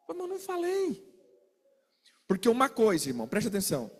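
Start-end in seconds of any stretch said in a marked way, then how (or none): 0.1-1.0 mock whiny denial. Acted quarrel, high soft denial.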